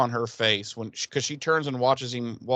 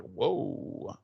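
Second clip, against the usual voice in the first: whispered whoa; 5 semitones lower, 7 dB quieter than usual. Retold or imitated. Shocked reaction, whispered.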